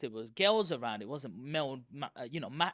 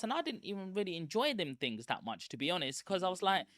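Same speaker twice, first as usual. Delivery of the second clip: ordinary talk, nothing marked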